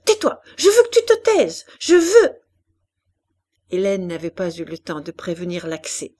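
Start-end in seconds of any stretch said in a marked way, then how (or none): 0.0-2.3 put-on very high, loud child's tantrum; furious little girl shouting; screaming in rage. A narrator's reading. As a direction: shriek the order in blind anger. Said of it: "elle s'élança sur lui en criant".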